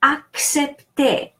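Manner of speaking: "Accepter" drawn out, final ER as E; French pronunciation. Shown as a demonstration. Teacher showing learners the French word, "Accepter" pronounced slowly. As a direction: say "Accepter" slowly, first C as K, second as S. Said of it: "The second C is pronounced S".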